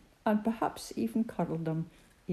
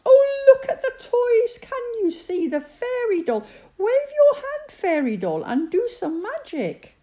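higher, louder squealing excitement; excited children; 7 dB louder than usual. A narrator's reading. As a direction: shriek with delight at the glowing tree. Children cheering at the tree.

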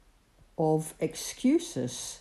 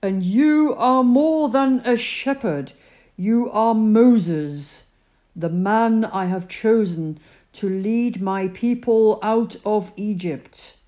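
God's voice; 4 semitones higher, 9 dB louder than usual. Acted. God speaks to Moses.

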